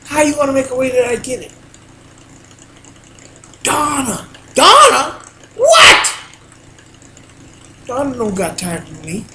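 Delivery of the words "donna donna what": rising exasperated call of her name; yelling a name across the house. Retold or imitated. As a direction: shout for Donna, fed up. Exasperated calling out to Donna.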